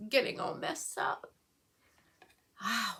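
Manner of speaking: choking up mid-sentence; voice breaking. Real, not acted. Vlogger explaining to viewers how her mind spirals, voice breaking. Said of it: "why am I getting all emotional".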